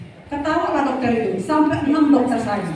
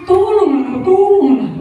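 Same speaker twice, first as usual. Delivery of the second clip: a touch of a frightened mother, higher and louder; begging mother; 5 semitones higher, 4 dB louder, slower than usual. Retold or imitated. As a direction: plead over and over. Sick mother begging for help.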